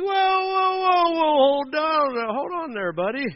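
high, loud whoas sliding down to normal; hammed-up alarmed slow-down, settling as it goes; cautioning older man. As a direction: rein in an overeager kid. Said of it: "being a good older person".

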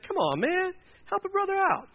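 high-pitched mock appeal; mock pleading. Pleading with the angry older brother over a gift, mock exasperated.

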